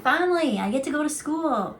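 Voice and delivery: high, loud burst of relief; excited. Retold or imitated. Recalling heading to school after the movie, excited. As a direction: cheer it like you've finally won. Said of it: "I was like".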